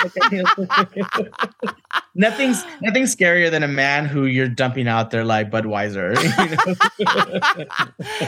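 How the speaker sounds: words broken up by real laughter; laughing. Real, not acted. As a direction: laugh through the punchline. Laughs through own punchline.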